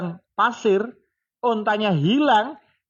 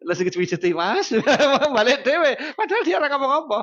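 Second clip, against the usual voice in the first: laughing through the words; storyteller cracking up; 4 semitones higher than usual. Real, not acted. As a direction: giggle as you tell it. Laughing while telling wife story.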